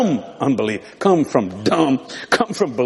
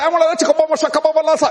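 mock eager calling; 12 semitones higher, much faster than usual. Retold calling out to the cop.

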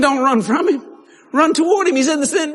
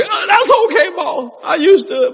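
mock trembling; 9 semitones higher than usual. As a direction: placate the boss in a timid high voice. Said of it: "He, he just trembling".

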